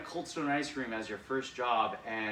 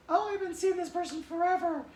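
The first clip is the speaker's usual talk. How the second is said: very high, put-on girl voice; girl's voice; 5 dB louder than usual. The crush answering David in a very high girl's voice. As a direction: play the crush brushing him off. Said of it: "the crush's reply, voiced".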